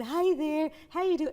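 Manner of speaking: put-on high, loud sing-song baby talk. Teen brother cooing to the baby in high baby talk. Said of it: "he's talking to him in baby talk".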